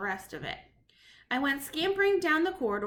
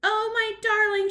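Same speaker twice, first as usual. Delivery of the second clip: loud, higher relieved cry; cried out; 9 semitones higher, 8 dB louder than usual. Acted. The grandmother cries out on seeing her grandson safe. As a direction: call out in relief. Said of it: "she cried".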